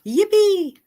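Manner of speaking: high, loud mock delight over a lost subscriber; sarcastic cheer. Cheering after losing a subscriber.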